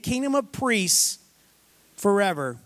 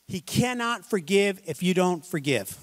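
ordinary talk

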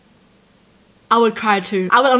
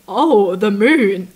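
drawn-out fond sigh; 4 semitones lower, much slower than usual. Real, not acted. Touched by moon story.

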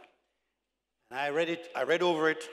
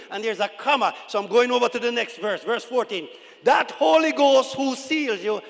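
loud, high sermon delivery; full-force preaching; 7 semitones higher, 10 dB louder than usual. Acted. Preacher explaining the seal and guarantee to church, full-force preaching. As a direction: proclaim it forcefully to the congregation.

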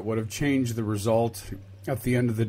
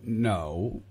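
normal talking voice

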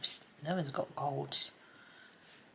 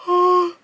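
big open-mouthed yawn; yawning out loud; 17 dB louder than usual. Real, not acted. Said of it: "I'm yawning".

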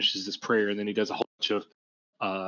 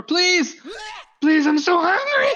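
very high, loud pleading shriek; desperate scream; 10 dB louder than usual. Acted. Starving captive begs from under the floor, screaming. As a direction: scream it out, begging for food. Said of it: "There's a scream coming up from under the floor".

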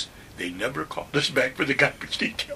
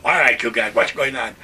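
louder sergeant's call, asking what's up; 8 dB louder than usual. Retold or imitated. Sergeant arriving and calling to the recruits, louder. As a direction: call out over the group.

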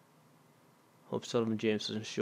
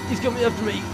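panicked; 11 semitones higher, 8 dB louder, faster than usual. Real, not acted. Panicked gamer chased in horror game.

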